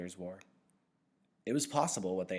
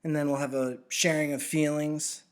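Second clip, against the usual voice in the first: louder, higher, bitter sneer; sarcastic; 8 semitones higher, 10 dB louder than usual. A narrator's reading. Jonas mocking the giver's plan, nasty sarcastic jab. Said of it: "the nasty, sarcastic voice again".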